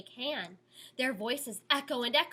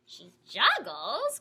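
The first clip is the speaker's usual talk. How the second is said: surprised; 4 dB louder, slower than usual. Acted. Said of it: "cried Penelope".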